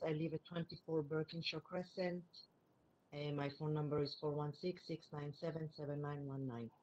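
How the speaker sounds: distant, on speakerphone; phone held away. Real, not acted. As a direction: caller far from the phone. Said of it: "Can you speak into the phone and don't use the speakerphone?".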